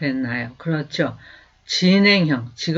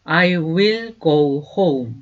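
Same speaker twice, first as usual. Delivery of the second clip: slow, clear teacher's model, each word apart; slow demo pronunciation; slow model sentence; much slower than usual. Shown as a demonstration. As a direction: say it slowly for students to repeat. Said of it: "그래서 go를 써주게 돼요".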